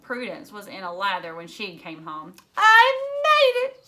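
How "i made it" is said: high, loud thrilled squeal; excited squeal. A narrator's reading. Prudence squealing her news to her sister, thrilled. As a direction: burst out with the news.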